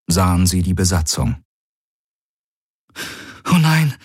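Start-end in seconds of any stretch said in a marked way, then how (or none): 3.5-3.9 higher-pitched, narrated gasp of dismay; dismayed exclamation. A narrator's reading. Kirai reacts to the sight of the crew, dismayed exclamation. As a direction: blurt it out in a higher character voice. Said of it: "hörte Galen Kirai ausstoßen".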